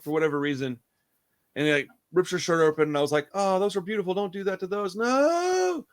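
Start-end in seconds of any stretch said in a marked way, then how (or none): 3.3-5.9 high mock-pleading, rising to a drawn-out wail; hammed-up protest. Viewer begging the killer to stop, high mock wail. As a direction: plead high, then stretch the last word higher.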